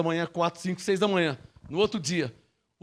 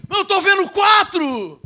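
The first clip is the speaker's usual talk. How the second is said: astonished shout; 7 semitones higher, 4 dB louder than usual. Acted. Astonished soldier calls out.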